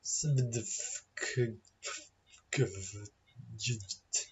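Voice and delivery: Sv-d-f-k-f-k-v-j-t as soft bare consonant sounds; consonants without vowels. Shown as a demonstration. Teacher trying a vowelless word, soft bare consonants. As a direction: sputter each consonant quietly, no vowels. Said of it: "Can we say it without a vowel".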